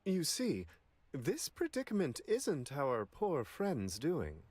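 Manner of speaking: ordinary delivery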